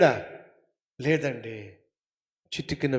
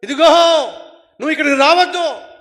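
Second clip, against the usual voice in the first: raised, loud call to his wife; shouted warning; 13 semitones higher, 14 dB louder, faster than usual. Acted. Husband in hell calling to his wife, shouted warning. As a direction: yell at her to stay away. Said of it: "అరుస్తున్నాడు".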